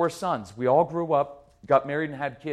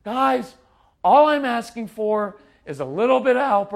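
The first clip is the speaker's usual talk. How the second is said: higher, louder mock pleading; 6 semitones higher, 7 dB louder than usual. Parent begging the kids for help, pleading. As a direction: plead like a put-upon parent. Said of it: "The pleading tone".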